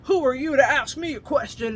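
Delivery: detective snapping at a suspect, mildly mocked; rude detective. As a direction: brush him off with contempt. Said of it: "the detectives are being assholes".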